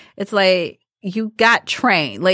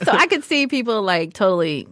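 ordinary talk, nothing marked

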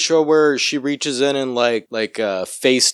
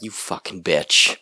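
mock jealous muttering; 9 semitones lower, 4 dB quieter, slower than usual. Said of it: "You were sitting there like".

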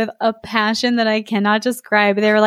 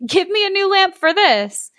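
lightly raised, eager servant; high servant voice; 9 semitones higher than usual. Acted. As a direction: pitch it up as a servant. Servant quote in read story.

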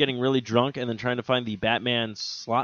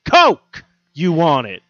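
higher, louder, very slow; insistent dealer; 5 semitones higher, 8 dB louder, much slower than usual. Retold or imitated. Dealer repeats his coke offer, loud and slowly insistent. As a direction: hammer the offer home slowly. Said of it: "So I go".

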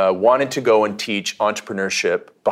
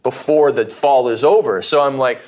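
ordinary talk, nothing marked

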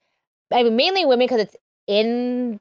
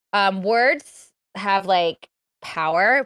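slow, weighty emphasis; much slower than usual. Real, not acted. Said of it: "stressing a key point".

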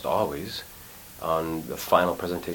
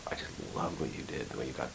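hushed praise; 5 semitones lower, 12 dB quieter, faster than usual. Retold or imitated. Retold showrunner praising him quietly.

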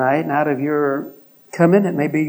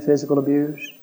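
normal talking voice

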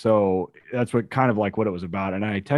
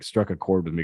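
ordinary talk

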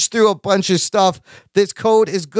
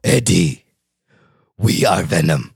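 much lower symbiote voice; Venom voice; 14 semitones lower than usual. Retold or imitated. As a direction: drop it way down for the symbiote. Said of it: "That's not bad".

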